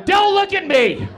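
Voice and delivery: very high, loud and slow shriek; panicked scream. Retold or imitated. Tripping guy at the party, screaming in panic. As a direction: shriek it out in terror.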